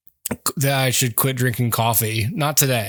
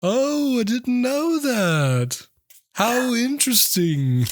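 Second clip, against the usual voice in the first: high, slow, hammed-up amazement; mock surprise; 10 semitones higher, slower than usual. Shown as a demonstration. Showing the always-surprised voice, high and slow. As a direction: stretch it out, dazzled. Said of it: "gotta go always surprised".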